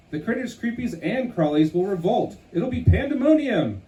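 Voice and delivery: high, alarmed critter voice; panicky critter. Acted. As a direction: wail it like doom is coming. Critter panicking about the jamboree.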